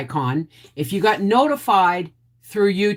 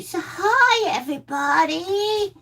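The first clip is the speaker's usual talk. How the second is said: much higher, cute voice on the dog's behalf; speaking for the dog. Pretend. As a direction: give the dog a squeaky little voice. Voicing the dog to viewers.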